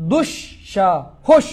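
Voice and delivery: "দুঃসাহস" very slow, in three separated pieces: dush, sha, hosh; syllable-by-syllable demo. Shown as a demonstration. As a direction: break "দুঃসাহস" apart with pauses between syllables. Teacher breaks word into syllables. ("দুঃসাহস" খুব ধীরে, ভেঙে ভেঙে: দুশ্, শা, হোশ)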